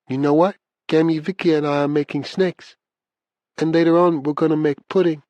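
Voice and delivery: put-on high little-girl voice; small, shy child. A narrator's reading. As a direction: pitch it up like a small child on the phone. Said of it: "Hearing her small, shy voice".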